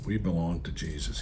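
a hushed, intimate echo of the line just preached; soft echo. Real, not acted.

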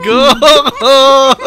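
calling out. Real, not acted. Player calling a lost teammate by name, loud. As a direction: call out Goro loud across the map.

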